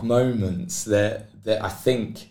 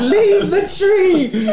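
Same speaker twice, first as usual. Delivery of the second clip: very high, loud and stretched-out mock holler; hammed-up plea hollered at top pitch; retold desperate yell; 7 dB louder, much slower than usual. Swimmer protecting his tree from the crew, mock desperate yell. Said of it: "like that".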